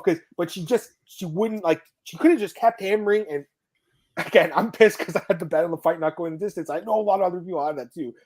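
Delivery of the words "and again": usual speaking voice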